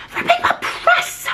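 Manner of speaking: breathless, very high, slow disbelief; can barely get the words out; stunned. Real, not acted. Gasping to viewers over a friend's gift, stunned.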